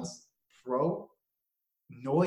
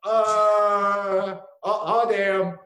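mock dismay; 4 semitones higher, 8 dB louder, much slower than usual. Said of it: "I was like".

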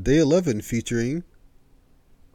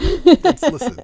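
high squeaky protest; excited squeal; 9 dB louder, much slower than usual. Real, not acted. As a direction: yelp out the first word, then insist. Cutting in to defend himself.

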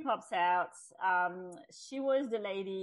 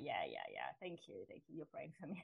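dismissive thanks; 6 semitones lower, 13 dB quieter, faster than usual. Retold or imitated. Dismissive reply to praying friend.

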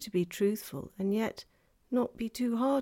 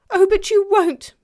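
put-on high, loud child's protest; alarmed pleading; 13 semitones higher, 15 dB louder than usual. A narrator's reading. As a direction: protest in panic as a young girl.

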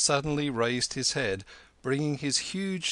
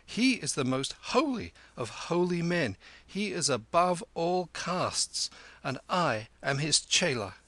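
higher-pitched, solemn proclamation; severe declaration; 7 semitones higher than usual. A narrator's reading. Kim addressing the stars about his master, severe. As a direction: proclaim it sternly to the air. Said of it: "Kim went on severely, addressing the stars".

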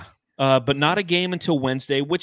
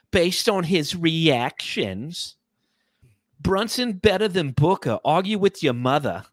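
put-on New York accent, higher voice; 4 semitones higher, slower than usual. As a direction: rant like a New Yorker. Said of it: "so I'll go back to my".

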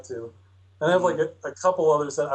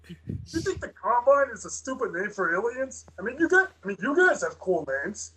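high, friendly cop; officer voice; 7 semitones higher than usual. Acted. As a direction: reassure him like a pushy cop. Acted officer questioning.

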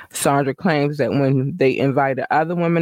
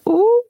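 squealed ooh way up high; shocked delighted ooh; high gossipy ooh; 6 dB louder than usual. Real, not acted. Reacting to celebrity sex tapes.

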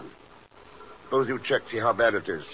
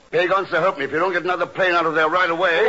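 louder, higher warning through clenched patience; raised exasperated ultimatum; exasperated threat; 5 semitones higher, 4 dB louder than usual. Acted. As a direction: lay down the law to him. Boss threatens late agent.

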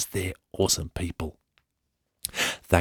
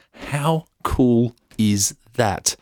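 higher, very slow, each word stretched; drawn-out enthusiasm; 5 semitones higher, much slower than usual. Real, not acted. Host marvelling at the lawn volunteers, drawn-out enthusiasm. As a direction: savour every word in admiration. Host exclaims over the story.

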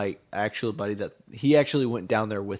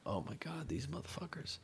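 very soft, under-the-breath disbelief; muttered aside; 5 semitones higher, 18 dB quieter than usual. Retold or imitated. Muttering to himself about the guys, very soft. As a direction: mutter it so they can't hear.

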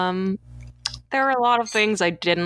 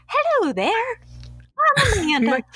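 put-on much higher, sugary Southern accent greeting; Southern accent Amanda. Podcaster mocking Amanda's tape message in a Southern accent. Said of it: "she turned Southern in my interpretation".

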